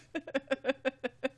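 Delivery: high, light laughter; high run of chuckles; real laugh. Real, not acted. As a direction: giggle at your own embarrassment. Laughs about children hearing this episode.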